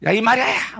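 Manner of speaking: high, brusque shout of a name; hammed-up sharp yell; mock rough call. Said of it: "뭐 이렇게 불렀을까?".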